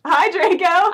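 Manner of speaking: normal talking voice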